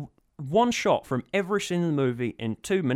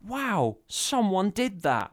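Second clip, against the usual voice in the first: mock amazed; 7 semitones higher, much slower than usual. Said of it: "so you can go".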